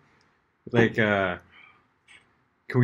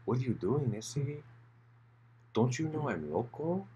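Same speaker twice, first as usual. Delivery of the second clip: soft, lilting put-on Chicano accent; 3 semitones higher, 9 dB quieter than usual. Shown as a demonstration.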